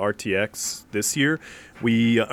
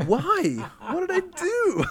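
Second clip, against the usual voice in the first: much higher, playing dumb; mock innocent; much slower than usual. Retelling his own clueless reply to Ernie, mock innocent and high. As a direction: squeak it out all innocent. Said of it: "And I was like".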